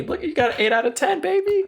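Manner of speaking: put-on high, hyped cheer, squeak on baby; excited. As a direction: celebrate like a game show host. Hyping a quiz score.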